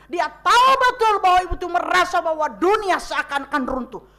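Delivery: loud, driving sermon delivery; forceful preaching. Acted. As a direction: preach it out to the congregation. (berkhotbah dengan suara lantang)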